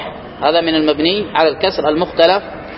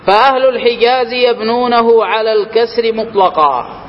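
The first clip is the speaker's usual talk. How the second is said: raised, measured recitation of a grammar text; formal text recitation; reciting the text; 4 semitones higher, slower than usual. Acted. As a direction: read the rule out like a set text. Reads the grammar text aloud.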